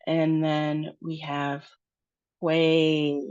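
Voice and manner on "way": way with the A held long. Shown as a demonstration. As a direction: stretch out the vowel in way. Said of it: "we have two long sounds".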